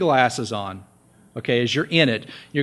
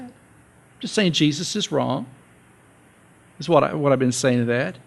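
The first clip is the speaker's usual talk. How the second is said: ordinary talk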